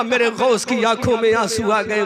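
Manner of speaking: ordinary talk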